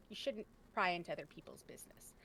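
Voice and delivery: whispered, no voice at all; hushed aside. Retold or imitated. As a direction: drop to a secret whisper. Dad's advice, retold in a hush.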